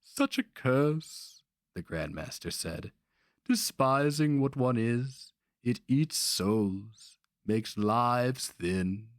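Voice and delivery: high, slow mock pity; sly old sorcerer, slow. A narrator's reading. Old Grandmaster pitying the barbarian, slow and high. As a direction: draw out the pity like a lament.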